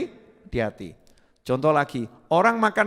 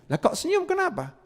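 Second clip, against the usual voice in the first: puzzled visitor; 10 semitones higher, 5 dB quieter than usual. Retold or imitated.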